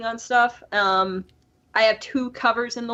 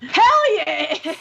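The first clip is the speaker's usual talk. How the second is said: louder, higher mock whoop; retold enthusiasm; 4 semitones higher, 5 dB louder than usual. Friend agrees to voice Lord Dominator, retold enthusiasm. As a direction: imitate a friend eagerly agreeing. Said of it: "He's like".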